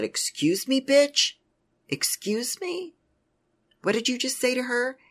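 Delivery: high, loud, outraged "excuse me, bitch?"; a friend jumping to her defense, high and loud in outrage; indignant outburst. Retold or imitated.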